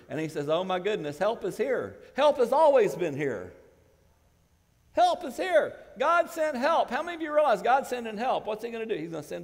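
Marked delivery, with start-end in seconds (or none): 0.5-3.5 loud, higher proclaiming; preaching at full force. Acted. Preaching Lucado's words, full force. 4.9-8.5 much higher, much louder climax; shouted pulpit climax; preaching at full force. Acted.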